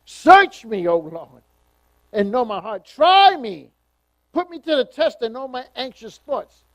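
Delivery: loud, ringing scripture reading; loud preaching. Acted. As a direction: preach it out with full force. Preacher reading psalm at full force.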